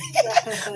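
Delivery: short high laugh at his own joke; laughing at his own story; high burst of laughter. Real, not acted. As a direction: break into a quick chuckle.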